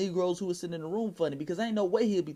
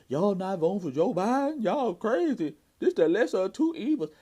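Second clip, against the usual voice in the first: higher, louder mock outrage; mocking incredulous voters; 4 semitones higher, 6 dB louder than usual. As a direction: imitate scandalized voters, pitched up and loud.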